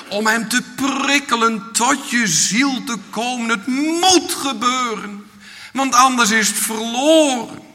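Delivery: impassioned sermon delivery, stretched key words; fervent preaching. Real, not acted. Preacher urging the congregation to seek Jesus, fervent preaching. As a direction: preach with urgency, leaning on the weighty words.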